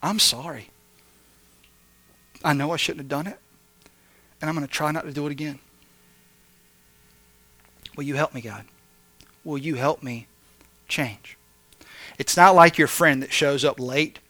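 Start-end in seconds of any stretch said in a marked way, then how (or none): 0.0-11.4 soft, lowered, humble plea; sincere confession. Acted. Sinner coming to God to repent, sincere and hushed. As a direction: pray it quietly, with pauses. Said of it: "He wants us coming to Him and say".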